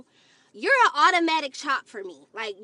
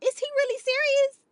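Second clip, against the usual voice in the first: high, stunned disbelief; squeaky shocked inner voice; 13 semitones higher, faster than usual. Retold or imitated. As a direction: gasp it out, high and incredulous. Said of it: "I'm like".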